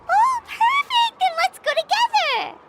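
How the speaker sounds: high, excited exclamation; delighted. Acted. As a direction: burst out with eager delight. Delighted at going to party together.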